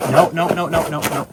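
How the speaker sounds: loud, quick scolding no's to a dog; firm no. Real, not acted. Owner stopping the dog from getting hurt, loud firm no's. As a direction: stop the dog right now, firmly.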